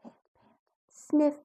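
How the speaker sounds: ordinary delivery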